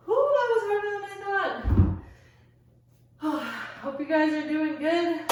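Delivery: out of breath. Real, not acted. Panting after finishing the set.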